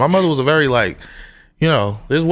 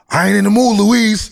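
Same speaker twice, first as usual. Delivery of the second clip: higher and louder, a gruff complaint; grumpy grandfather; 9 semitones higher, 5 dB louder than usual. Retold or imitated. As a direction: snap at Louise like an irritated old man.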